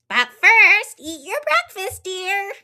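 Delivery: high, loving mom voice with a loud first. Acted. Mom reminds Froggy about breakfast, high and firm. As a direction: gently stop him at the door.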